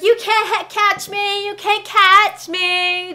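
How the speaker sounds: high, loud sing-song teasing; taunting little kid. Retold or imitated. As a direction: imitate a child's drawn-out taunt. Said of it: "Just like little children do".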